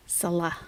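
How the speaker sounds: Salah said softly as suh-LAH; quiet Salah, brief unstressed first syllable; correct stress. Shown as a demonstration. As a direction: demonstrate Salah with weight on the LAH. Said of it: "emphasis is always on the wrong syllable".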